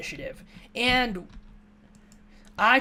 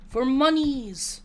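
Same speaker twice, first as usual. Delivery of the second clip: slow, louder, higher mock proclamation; mock grand cry; 5 semitones higher, 5 dB louder, slower than usual. To the game market, a slow hammed-up cry for money. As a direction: hams it up like a greedy merchant.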